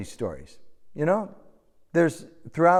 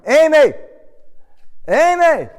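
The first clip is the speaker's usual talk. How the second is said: high, loud desperate calls of a name; panicked father shouting across a store; frantic shouting; 11 dB louder than usual. Retold or imitated. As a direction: call out for a lost child in panic. Frantic father calling lost daughter.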